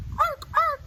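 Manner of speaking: very high, loud bird shriek; jay screech. Acted. Reading the jays' scream aloud, high loud shriek. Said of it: "The jays screamed".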